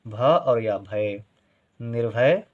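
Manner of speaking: भ और या भय drawn out very slowly; patient teacher spelling aloud; slow sounding out. Shown as a demonstration. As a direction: join भ and य slowly into भय. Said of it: "इसका उच्चारण होगा निर्भय".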